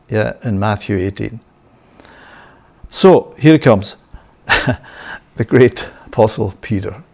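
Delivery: usual speaking voice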